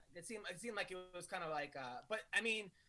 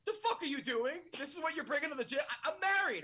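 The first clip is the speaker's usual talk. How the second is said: rising angry rant, higher and louder; indignant outburst; 8 semitones higher, 5 dB louder than usual. Retold or imitated. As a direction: scold him in disbelief. Angry friend scolding at gym.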